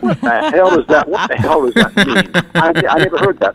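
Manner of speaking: retold laughing disbelief; laughing retort. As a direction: laugh through the question. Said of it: "And I got a big grin".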